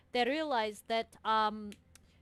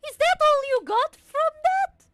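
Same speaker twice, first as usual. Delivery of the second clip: loud, high disbelieving yell; high incredulous shout; 12 dB louder than usual. Acted. Sister's incredulous outburst.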